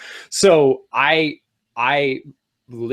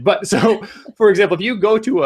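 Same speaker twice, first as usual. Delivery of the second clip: higher, quicker, with a laugh breaking in; can't contain the enthusiasm, pitch up; getting excited; 8 semitones higher than usual. Real, not acted. Host apologizing to co-host for rushing, excitedly high. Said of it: "I'm sorry, 'cause I'm getting excited".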